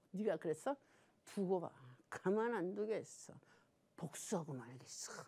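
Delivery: low, hushed acted threat, sinking to a whisper; brooding revenge vow. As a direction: swear revenge quietly to yourself. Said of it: "속에서 품는 거예요". (속으로 복수를 다짐하듯 낮게 속삭이며)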